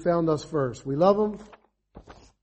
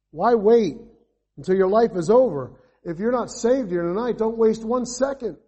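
rising to full-force preaching, louder and higher; fervent preaching; 4 semitones higher, 5 dB louder than usual. Acted. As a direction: urge them not to wait, building to a cry.

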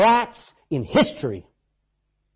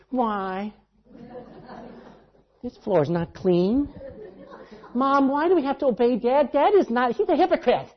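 high, petulant back talk; lightly mocking a sulky teen; mock whiny teenager; 6 semitones higher than usual. A modern teenager refusing to obey Dad, mock whiny. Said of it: "Can you imagine a t-, modern teenager?".

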